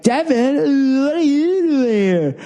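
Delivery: high, slow, drawn-out valley drawl; Californians sketch voice. Retold or imitated. Imitating a Californians character greeting Devin, high and drawn out. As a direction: ham up the SNL Californian whine. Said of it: "The Californians".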